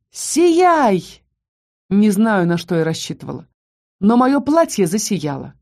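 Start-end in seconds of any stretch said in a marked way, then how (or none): 0.1-1.2 high, drawn-out cry of command; full-throated yell. A narrator's reading. Heroine commanding her magic to work, high yell. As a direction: call it out high at the top of your lungs. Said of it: "во всё горло заорала".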